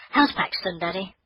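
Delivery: put-on high, eager child's voice; a girl rushing out to see her deer; eager little girl.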